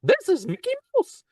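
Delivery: very high squeaky cartoon mouse; high-pitched Mickey Mouse voice; Mickey Mouse impression. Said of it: "you heard my Mickey Mouse".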